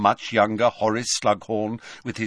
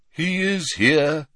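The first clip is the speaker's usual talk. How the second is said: slow, gentle ghost voice; a courtly old ghost, unhurried; ghostly Nick voice; 6 semitones higher, slower than usual. Acted.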